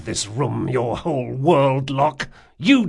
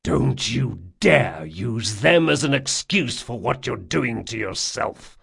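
growled warning. A narrator's reading. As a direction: warn your friend off angrily. Continues growled warning to friend.

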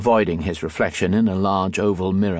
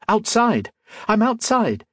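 much higher, thrilled cry of discovery; overjoyed; 14 semitones higher than usual. A narrator's reading. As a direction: burst out with a child's delight at being outdoors. Boy finally steps outside, overjoyed.

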